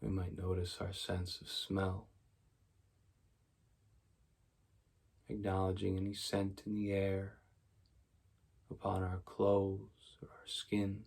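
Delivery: soft, even meditation voice with long pauses; quiet, unhurried mindfulness guidance; guided meditation. Real, not acted. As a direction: guide them gently into stillness, leaving space after each phrase.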